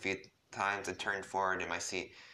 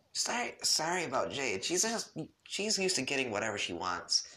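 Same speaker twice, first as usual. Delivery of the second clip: higher, lightly voiced boy; boyish apology; 6 semitones higher than usual. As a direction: apologize brightly as John. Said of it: "John said".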